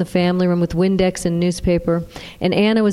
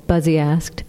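usual speaking voice

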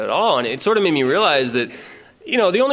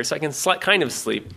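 ordinary talk, nothing marked

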